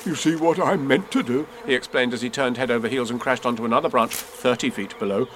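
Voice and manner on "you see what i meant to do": higher, lightly flustered bear voice; falling bear explaining. Acted. Bear tumbling head over heels, mildly flustered explaining. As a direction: explain yourself mid-tumble.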